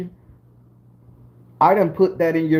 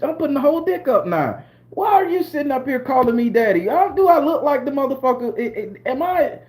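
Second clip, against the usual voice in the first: ordinary talk, nothing marked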